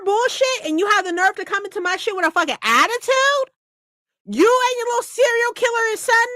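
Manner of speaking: high, heated mobster rant; indignant rant. Acted. Mobster hero ranting at the heroine, indignant. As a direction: tear into her, outraged.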